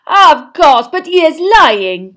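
high, very loud, slow accusation; haughty outburst from a French headmistress; crying out. A narrator's reading. Headmistress accusing Harry of lying, crying out. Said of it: "cried Madame Maxime".

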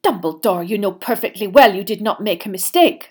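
firm indignation, understated; angry. A narrator's reading. Professor defending Dumbledore's work to him, angrily. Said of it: "said Professor McGonagall angrily".

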